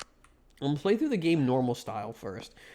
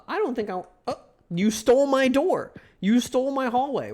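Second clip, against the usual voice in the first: higher, louder outraged complaint; indignant surprise; 8 semitones higher, 3 dB louder than usual. Real, not acted. Player outraged the game took his door, high and loud. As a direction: accuse the game of theft.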